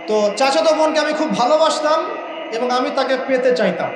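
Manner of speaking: ordinary talk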